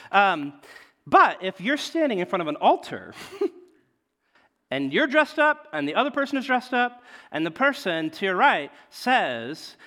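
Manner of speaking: ordinary talk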